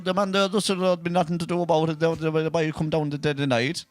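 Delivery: put-on lightly done thick Kerry country accent; a hint of a rural Kerry farmer's brogue. Asked by cohost to do a Kerry accent, lightly voiced. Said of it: "your Kerry sheepdog accent".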